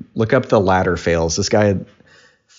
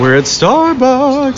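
mocking; 4 dB louder, much slower than usual. Mocking the whisper.

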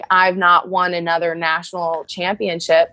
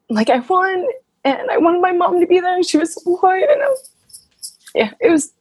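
fighting back tears while remembering; real tears catching in a high voice; choked up; 11 semitones higher than usual. Real, not acted. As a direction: voice rising high and tight with real emotion. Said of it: "I'm getting emotional right now".

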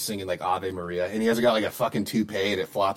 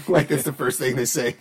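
usual speaking voice

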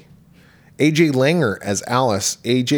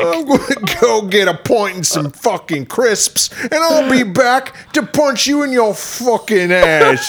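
much higher, slow shouted Cockney; mock Cockney rant; 9 semitones higher, 3 dB louder, slower than usual. Mocking the duchess, a slow shouted Cockney rant. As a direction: ham up a Cockney threat. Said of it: "She's fucking Cockney".

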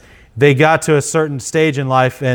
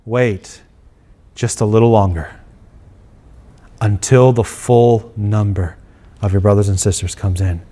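God's patient answer; 7 semitones lower than usual. Retold or imitated. God answers the martyrs.

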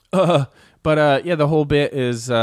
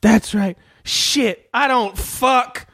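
raised, heated cop rant; Denzel rant impression; 7 semitones higher, much slower than usual. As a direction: swagger through it. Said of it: "Denzel Washington's character".

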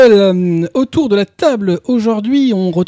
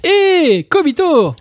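announcing; 8 semitones higher, slower than usual. Real, not acted. Host announcing a guest.

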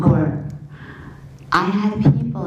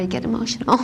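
ordinary talk

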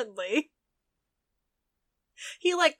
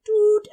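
high, loud imitated horn blast; sudden bright vocal "doot"; horn toot; 9 semitones higher, 7 dB louder than usual. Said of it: "just being like".

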